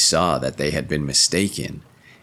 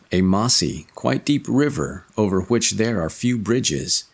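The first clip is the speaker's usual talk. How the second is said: plain reading voice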